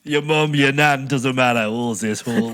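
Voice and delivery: matter-of-fact Yorkshire list falling to the catchphrase; Yorkshire accent. Acted. Telling the lads it doesn't matter, Yorkshire accent. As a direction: settle it bluntly in Yorkshire. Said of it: "in a Yorkshire accent".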